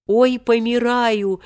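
put-on slow, high, feeble old man's groan; drawn-out dying complaint; wheezed moan. A narrator's reading. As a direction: wail it out like a decrepit elder. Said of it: "прохрипел дряхлый старец Серёжа".

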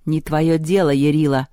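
usual speaking voice

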